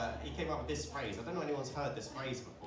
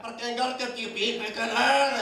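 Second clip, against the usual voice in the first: loud, drawn-out mock tirade; high, booming fake abuse; mock angry outburst; 7 semitones higher, 10 dB louder, slower than usual. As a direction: roar it out as a comic rant. Mock rude outburst at audience.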